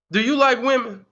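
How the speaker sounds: normal talking voice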